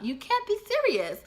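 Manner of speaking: very high-pitched exasperation; incredulous. Retold or imitated. Woman eyeing him in rearview mirror, high incredulity. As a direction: send the pitch soaring in disbelief.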